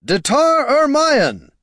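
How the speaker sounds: high, loud, drawn-out heroic challenge; trumpet-like call. A narrator's reading. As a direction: ring the name out like a trumpet. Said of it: "called a voice like a trumpet".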